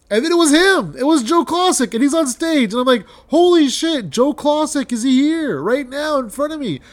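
very high, loud burst of amazement; starstruck excitement. Retold or imitated. Fan retelling his legend appearing on stage, ecstatic disbelief. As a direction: reenact losing it at the sight of an idol.

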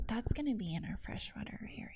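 hushed, near-whispered tour guide; polite, professional. Retold or imitated. Tour guide gently correcting a guest, hushed polite voice. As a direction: answer softly and tactfully. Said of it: "he handled it very professionally and really nicely".

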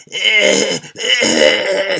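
imitating a choking woman with strained, high gasps; mock choking gasps. Retelling a friend choking on hot sauce, mock strained gasps. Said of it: "she starts coughing and gasping and hacking".

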